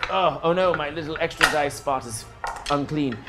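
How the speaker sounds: ordinary delivery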